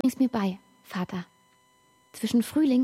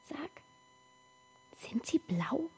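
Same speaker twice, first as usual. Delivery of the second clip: whisper; 7 semitones higher, 10 dB quieter than usual. Acted. Whispered question to father.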